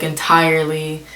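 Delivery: ordinary talk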